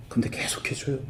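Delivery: confiding whisper; hushed aside to the congregation. Real, not acted. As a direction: whisper it like a guilty secret. Confessing he still does it.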